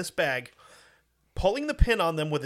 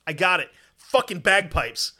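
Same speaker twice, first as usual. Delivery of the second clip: louder, punchy eureka; triumphant idea; 3 semitones higher, 7 dB louder than usual. Retold or imitated. Commander announcing his idea, louder and triumphant. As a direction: announce the brainwave proudly.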